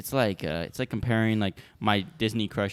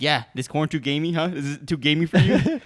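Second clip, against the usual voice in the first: higher, louder needling questions; retold teasing; 4 semitones higher, 5 dB louder than usual. Friend ribbing a picky eater, teasing. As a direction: tease with a laugh creeping in. Retelling friend's mocking challenge.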